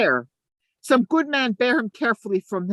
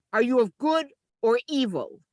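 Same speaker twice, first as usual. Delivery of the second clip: ordinary talk, nothing marked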